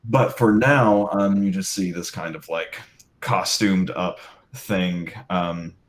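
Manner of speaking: ordinary delivery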